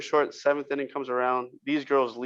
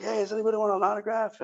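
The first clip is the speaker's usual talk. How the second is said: mock calling out; 8 semitones higher than usual. Holding a Sharpie among the fans, mock calling out. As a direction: imitate hollering an offer to a crowd.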